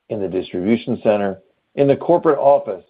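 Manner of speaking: normal talking voice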